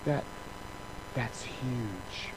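awed and affectionate; a hint of God moved by it; tender praise. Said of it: "He says".

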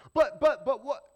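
loud, high "but, but, but what?"; mock protest. Pastor voicing a protesting churchgoer, loud and high. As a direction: play the objecting listener, loud.

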